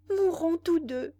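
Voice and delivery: high, loud delirious cry; frenzied cry. A narrator's reading. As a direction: cry it out in terror. Delirious woman in terror.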